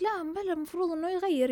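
high, sing-song mock protest; mocking imitation of doubters. Mocking the arguing people again. (سخرية من المعترضين بصوت أعلى)

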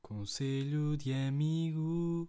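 slow, loud announcer call of "Conselho de Amigo"; segment announcer. Acted. Host introducing the advice segment, slow loud announcer voice.

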